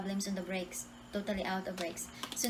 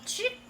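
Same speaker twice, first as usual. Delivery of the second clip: sharp, high mouth-made click; imitated "chik" noise. Sudden moment while driving, imitated chik noise. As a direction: make a sudden little sound effect.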